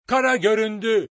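high, full-voiced shout; lookout's cry. A narrator's reading. Lookout announces land to the crew, shouting high and loud. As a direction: cry out across the whole ship. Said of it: "duyulan bir çığlık".

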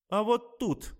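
patient showing the doctor where she was left, gloating. Acted. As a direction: rub it in with glee. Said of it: "злорадно ответила я".

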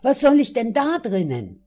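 startled protest. A narrator's reading. Narrator balking at entering parliament, startled. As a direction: protest in alarm, voice pushed up. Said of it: "fragte ich erschrocken".

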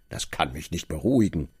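usual speaking voice

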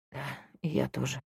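faint dying whisper; barely audible whisper. Acted. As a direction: breathe the words out, barely there. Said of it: "едва слышно прошептал".

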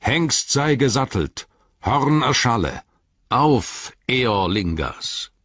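high, ringing battle cry; clear, far-carrying call. Acted. King calling the people of Rohan to arms, ringing battle cry. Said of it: "mit klarer, weittragender Stimme rief er".

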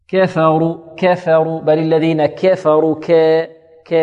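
"كفروا" بفتحة كاف مائلة إلى الكسرة; أمل "ك" في "كفروا" نحو الياء غلطًا; إمالة فتحة الكاف. Shown as a demonstration. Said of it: "يحذر من إمالة الكاف فتحتها". (انطق "كفروا" قريبًا من "كِيفروا")

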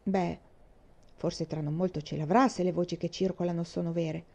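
ordinary delivery

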